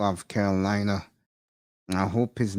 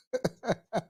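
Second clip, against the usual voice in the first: high laugh; 12 semitones higher, 6 dB quieter than usual. Real, not acted. Laughing at inside joke.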